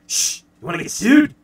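loud, high cartoon shushing; Groundskeeper Willie hushing. Willie warning the boy about copyright, loud mock hush. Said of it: "Groundskeeper Willie, and he's just like".

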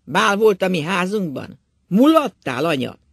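loud, mocking question to the wife; loud taunt. A narrator's reading. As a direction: jeer at her, voice climbing. Drunk husband taunting wife.

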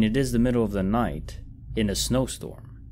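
ordinary delivery

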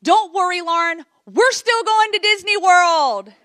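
high, loud holler across an arena; mom shouting encouragement. Retold or imitated. Mom calls to her fallen daughter from the stands, shouting reassurance. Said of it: "my mom jumps out of the stands and she says".

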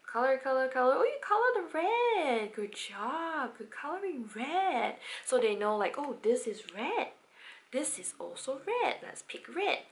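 put-on lively, bouncy praise for a child; excitable tone. Shown as a demonstration. Parent praising child's coloring, excitedly. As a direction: play it up for a toddler, cheering. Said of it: "an excitable tone".

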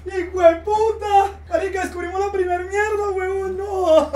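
loud, high disgusted yell; shocked shouting. Real, not acted. Shocked by clip.

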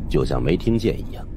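ordinary delivery, nothing marked

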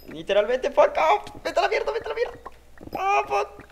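high yelled fuck off, very loud; frustrated shouting. Real, not acted. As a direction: scream the curses in frustration. Frustrated gamer yelling curses.

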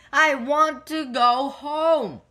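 high, drawn-out cross yell. A narrator's reading. Cross Kipper begs the key for home, yelling. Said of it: "he yelled".

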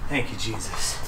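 low, soft murmured thanks; quiet prayer. Real, not acted. Vlogger prays before opening the chest, soft low thanks. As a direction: thank God quietly under your breath. Praying over the locked chest.

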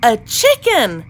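loud storybook exclamation; shouted. A narrator's reading. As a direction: call it out in loud amazement. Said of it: "they all shouted".

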